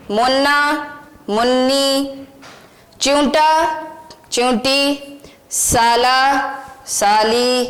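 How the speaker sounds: put-on each word said slowly and clearly, for children to repeat; teacher reading vocabulary to repeat; word list read aloud. Shown as a demonstration. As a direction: model the words for a class to echo. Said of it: "आप मेरे साथ पढ़ें".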